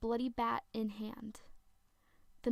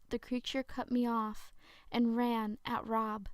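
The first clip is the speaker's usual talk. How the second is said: usual speaking voice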